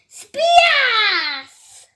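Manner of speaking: high, loud, drawn-out sound word; exclaimed splash. A narrator's reading. Four tyrannosauruses crash into the river, loudly exclaimed. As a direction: burst it out like the impact.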